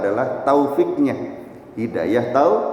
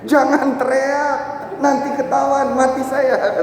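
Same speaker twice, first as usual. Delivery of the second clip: much higher, urgent hushing plea; panicked plea; 11 semitones higher than usual. Acted. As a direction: beg him to stop shouting, scared. Panicked robber silences the shouting man.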